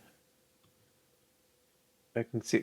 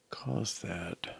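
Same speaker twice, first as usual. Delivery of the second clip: trailing off into a whisper; whispered puzzlement; 4 semitones lower, 9 dB quieter than usual. Real, not acted. Coder baffled by his own bug, whispering. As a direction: let it fade to a puzzled whisper. Puzzled over a strange result.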